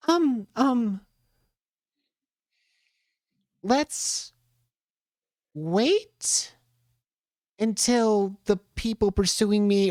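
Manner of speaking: high, hesitant character. Acted. A creature character answers.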